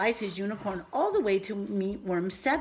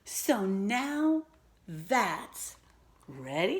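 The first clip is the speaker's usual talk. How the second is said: normal talking voice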